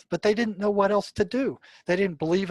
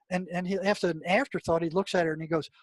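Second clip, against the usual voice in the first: usual speaking voice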